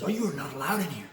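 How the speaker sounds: loud, fast, alarmed outburst; freaked-out shout. Retold or imitated. Store clerk panicking at a customer, loud and fast. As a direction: blurt it out in a panic, loud and quick. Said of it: "she was, like, really animated and freaked out".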